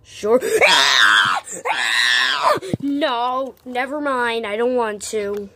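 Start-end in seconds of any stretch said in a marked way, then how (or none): 0.4-2.8 loud shrieking laugh-scream; girl scream. Shown as a demonstration. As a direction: let out a mock girly scream. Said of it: "Wanna hear my girl scream?".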